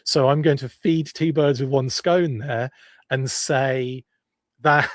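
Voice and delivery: ordinary talk, nothing marked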